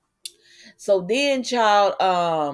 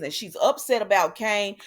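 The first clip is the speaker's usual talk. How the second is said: usual speaking voice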